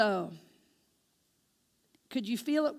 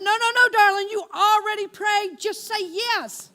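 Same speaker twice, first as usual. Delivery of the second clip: loud, higher, insistent; emphatic urging; 6 semitones higher, 6 dB louder than usual. Retold or imitated. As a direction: push her to just say yes. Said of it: "I said".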